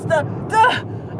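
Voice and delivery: ordinary talk, nothing marked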